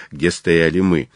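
plain reading voice

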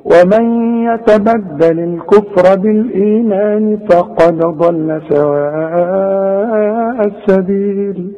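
slow melodic tajweed chant; Quran recitation. Acted. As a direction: recite slowly with held vowels.

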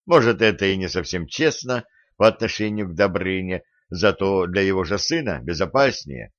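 plain reading voice

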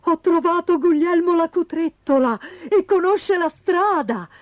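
high-pitched excited news; excited kangaroo. A narrator's reading. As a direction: blurt out good news in a high voice. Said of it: "affannosamente dall'eccitazione". (canguro eccitato, voce acuta)

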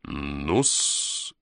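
low, slow smoky drawl; drawn-out "ну-с". A narrator's reading. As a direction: let it out with the smoke. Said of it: "произнёс вместе с дымом".

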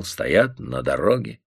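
usual speaking voice

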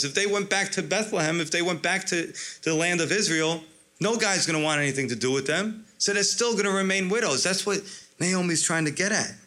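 ordinary delivery

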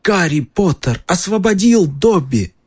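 high, slow squeaky elf; a tiny creature overjoyed; house elf. A narrator's reading. Elf repeats joyfully.

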